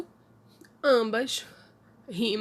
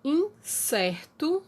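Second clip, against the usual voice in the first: slow example word; 5 semitones higher, much slower than usual. Shown as a demonstration. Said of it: "então perto".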